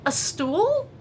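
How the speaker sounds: high, loud incredulous rise. Real, not acted. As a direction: ask it in total disbelief. Listing items carried out.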